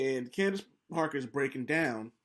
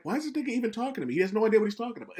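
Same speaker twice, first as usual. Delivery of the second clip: higher, fast, incredulous aside; exasperated inner voice; 7 semitones higher, much faster than usual. Retold or imitated. Candace's look at Shaq voiced, fast incredulous aside. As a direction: rattle it off in disbelief. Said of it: "looking at him like".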